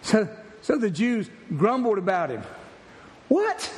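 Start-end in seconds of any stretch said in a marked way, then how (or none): 3.3-3.7 sharp high rhetorical what; preacher's incredulous what; mock surprise. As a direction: feign shock at the grumbling. Mock disbelief at the crowd.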